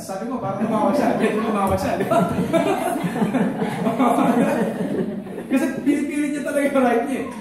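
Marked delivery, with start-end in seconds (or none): 2.2-5.5 a long open laugh at his own joke; hearty laugh. Real, not acted. Laughing after the pun to the congregation, open hearty laugh. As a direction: laugh it out freely.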